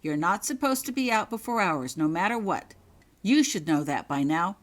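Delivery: loud scolding; stern lecture. Acted. Prefect lecturing Holly on rules, loud stern rebuke. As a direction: rebuke her firmly.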